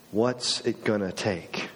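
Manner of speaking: low, slow and worn down; weary exasperation. Acted. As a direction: say it slowly, nearly out of patience. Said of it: "at some point you just".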